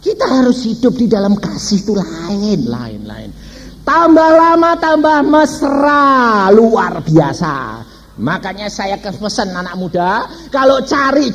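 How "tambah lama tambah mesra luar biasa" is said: slow, high and lingering; slow, relishing praise of lasting love; savoring slowly. Real, not acted. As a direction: stretch each word out with delight.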